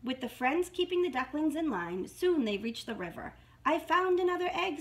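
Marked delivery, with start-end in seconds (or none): 3.6-4.8 high, loud little mouse; lightly voiced mouse, pitched high; squeaky mouse. A narrator's reading. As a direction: squeak out an excited find. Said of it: "squeaked Mouse".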